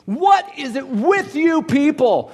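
high, slow mock rant; a mild hammed-up rant. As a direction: rant at them in disbelief. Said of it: "he starts ranting".

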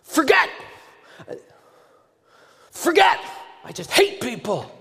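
high, loud parting yells; angry shouting. Retold or imitated. Stranger stomping off from the pastor, angry shout. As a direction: fling the words back while stomping away.

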